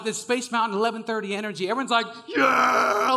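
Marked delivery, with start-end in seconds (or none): 2.3-3.1 loud, high, drawn-out cheer; crowd yelling. Retold or imitated. Riders losing their minds in Space Mountain, yelling. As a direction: roar it like an excited crowd. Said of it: "They start, like, yelling".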